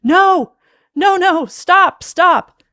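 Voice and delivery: high, full-volume screaming; panicked screams; terrified shriek. Acted. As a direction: shriek for it to stop. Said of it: "he had to shriek again".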